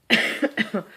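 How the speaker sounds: real dry cough; coughing fit. Real, not acted. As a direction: cough mid-sentence, unvoiced. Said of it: "I'm coughing so much this week".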